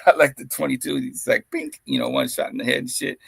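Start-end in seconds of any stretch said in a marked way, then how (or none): 1.5-1.8 gunshot noise. Retold or imitated. Gunshot sound imitated.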